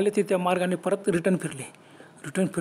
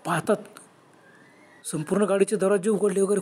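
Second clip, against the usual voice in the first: ordinary delivery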